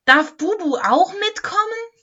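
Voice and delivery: high, loud, eager plea; excited asking. A narrator's reading. Lametta begs to bring her friend Bubu, excitedly. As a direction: ask excitedly, pitched right up. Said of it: "sagte Lametta aufgeregt".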